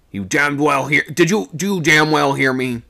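loud, furious demand; angry shout. A narrator's reading. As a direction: bellow it at him. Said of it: "he sung out".

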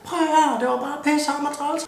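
mock whiny woman. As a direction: pitch it up and sulk like a woman complaining.

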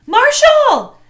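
calling out across the court, high and loud; shout. A narrator's reading. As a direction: yell his name to get his attention. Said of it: "she shouted".

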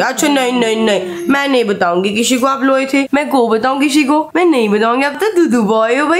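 playful motherly coo. Real, not acted. Mother teasing and soothing her baby.

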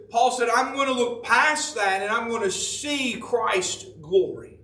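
impassioned preacher voicing Paul; fiery preaching. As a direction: drive it home to the church. Preaching at full force.

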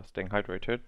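ordinary delivery

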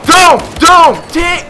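loud, high, urgent yells; panicked shouting. Real, not acted. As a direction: yell out in alarm mid-fight. Sudden danger in the game.

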